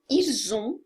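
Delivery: "ils ont" linked with a z sound, "ilz-on"; liaison z sound. Shown as a demonstration. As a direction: join "ils ont" so the s becomes z. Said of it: "vai ter o som de Z".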